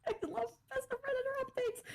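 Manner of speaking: high, squeaky words through giggles; giggling fit. Real, not acted. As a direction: try to talk while cracking up. Laughing fit while reading.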